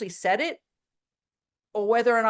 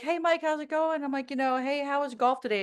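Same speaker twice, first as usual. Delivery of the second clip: cheery greeting; 6 semitones higher than usual. Retold or imitated. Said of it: "I'd be like".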